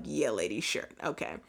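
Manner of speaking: dismissive dogs. Retold or imitated. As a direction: brush her off lazily. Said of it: "They're like".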